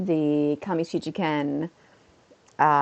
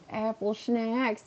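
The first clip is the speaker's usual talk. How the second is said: slow, soft, slightly high silly voice; silly schnacks voice; 4 semitones higher, 6 dB quieter, much slower than usual. Shown as a demonstration. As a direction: draw the words out softly, playfully. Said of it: "exactly how I would say it".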